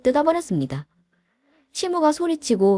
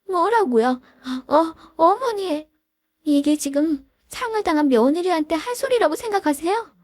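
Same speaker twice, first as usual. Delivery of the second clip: higher, hurt and stunned daughter-in-law; voiced shocked daughter-in-law; 10 semitones higher than usual. Grieving daughter-in-law confronts mother-in-law, shocked. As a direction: stammer in disbelief, lightly acted.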